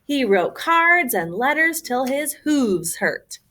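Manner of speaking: plain reading voice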